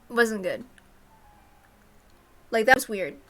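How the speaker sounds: plain reading voice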